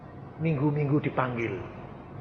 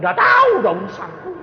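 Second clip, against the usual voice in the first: dismissive retort; 8 dB louder, faster than usual. Retold or imitated. Retold dismissive reply.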